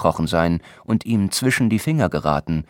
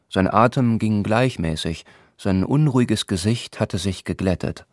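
usual speaking voice

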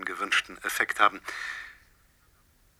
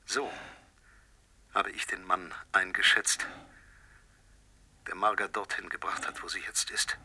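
much softer, low, near a whisper; cold quiet; 3 semitones lower, 9 dB quieter than usual. Acted. Poisoner confirms his guess, cold and quiet. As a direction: let the menace sink in.

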